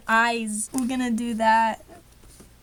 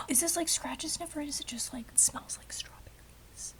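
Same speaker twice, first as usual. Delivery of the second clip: hushed, awed whisper; 3 semitones higher, 11 dB quieter than usual. Real, not acted. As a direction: whisper it like a precious secret. Awed over scented tape.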